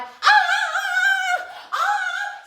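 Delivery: long high flamingo shrieks; squawk-scream like an alarmed flamingo; drawn-out, high, playful bird screams; imitated flamingo scream. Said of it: "poked their arms out and went".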